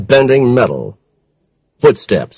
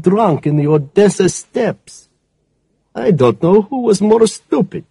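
higher, scornful woman's voice; lightly voiced contemptuous crime boss; villainess voice; 7 semitones higher than usual. A narrator's reading. Villainess confesses.

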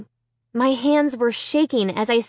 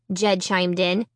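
plain reading voice

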